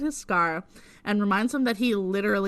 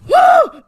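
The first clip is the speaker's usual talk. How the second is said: very high, loud "woo!"; overwhelmed whoop; 16 dB louder than usual. Real, not acted. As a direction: let out a big piercing whoop. Reacting to the dive.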